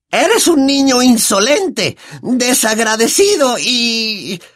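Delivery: high, slow furious aunt voice; Aunt Marge enraged. Acted. Aunt berates Harry, high and slow with fury. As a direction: swell with rage as she scolds.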